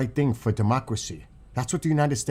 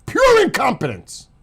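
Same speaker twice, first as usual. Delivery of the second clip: sharp, much higher and louder outburst; real anger; 12 semitones higher, 6 dB louder than usual. Real, not acted. Ranting commentator bursts out angrily over incompetence. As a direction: spit the words out in real disgust. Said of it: "I am so pissed".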